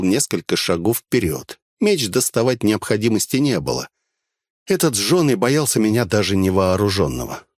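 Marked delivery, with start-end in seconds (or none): none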